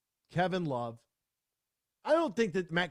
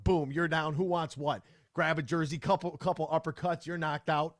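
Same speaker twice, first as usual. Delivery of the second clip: ordinary delivery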